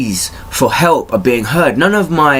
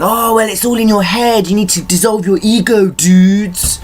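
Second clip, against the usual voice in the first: higher, sing-song mockery of know-it-alls; higher mocking spiritual voice; mocking preachy voice; 8 semitones higher than usual. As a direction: sneer at the advice-givers in a lifted voice. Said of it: "They're all going".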